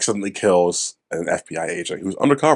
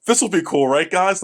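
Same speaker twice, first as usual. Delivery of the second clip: higher, eager mock voice; mocking the movie; 10 semitones higher than usual. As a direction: play the movie begging for approval. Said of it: "This movie's like".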